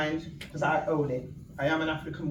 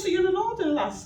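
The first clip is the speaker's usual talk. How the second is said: imitating the minister; 5 dB louder than usual. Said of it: "He goes".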